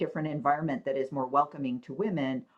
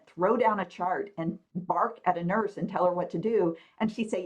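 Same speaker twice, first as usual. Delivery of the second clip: ordinary talk, nothing marked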